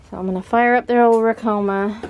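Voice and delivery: ordinary talk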